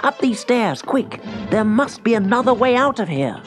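high, hurried call to escape; rally your companion while fleeing; urgent alarm. A narrator's reading. Fleeing the guards, calling out.

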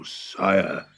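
ordinary delivery

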